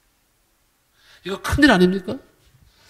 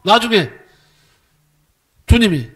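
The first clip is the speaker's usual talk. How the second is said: ordinary delivery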